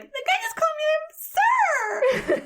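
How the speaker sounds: squealed mock outrage, extremely high; highest pitched voice. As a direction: imitate yourself shrieking in indignant disbelief. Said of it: "in probably my highest pitched voice".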